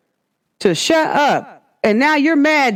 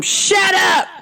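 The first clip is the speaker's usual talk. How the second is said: sharp higher yell of a teacher to a class; mock shout; 8 semitones higher than usual. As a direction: bark it at the whole classroom, imitating. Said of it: "By telling the whole classroom".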